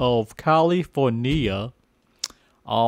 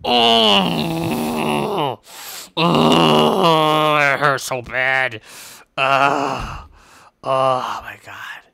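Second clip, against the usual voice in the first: crying in pain; much slower than usual. Pretend. Whale attack, hurting.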